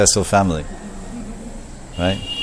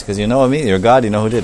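normal talking voice

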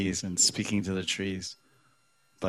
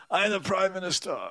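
put-on drunk man's claim; 11 semitones higher, faster than usual. Drunk man repeating his boast to passing cars, high and dazed.

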